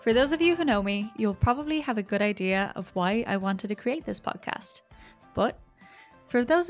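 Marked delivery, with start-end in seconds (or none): none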